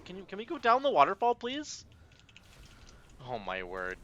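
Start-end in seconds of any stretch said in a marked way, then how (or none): 0.1-1.8 pleading. Real, not acted. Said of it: "pleading with party".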